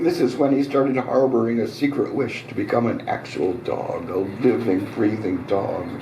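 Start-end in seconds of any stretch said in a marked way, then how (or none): none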